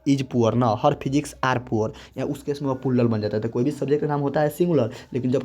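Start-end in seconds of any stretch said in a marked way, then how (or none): 0.1-1.9 very slow, word by word; drawn-out classroom example; slow dictation. Shown as a demonstration. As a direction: spell out the correct sentence slowly. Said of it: "ये ना कहेंगे कि".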